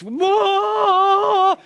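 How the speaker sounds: high, loud panicked whoa; childish scream. Retold or imitated. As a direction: yelp it like a terrified kid. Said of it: "he screamed like a four-year-old".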